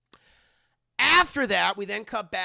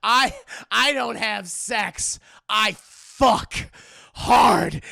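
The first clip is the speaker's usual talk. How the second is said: loud, slow, hammed-up villain boast; 5 semitones higher, 12 dB louder, much slower than usual. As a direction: growl it like a cartoon bad guy.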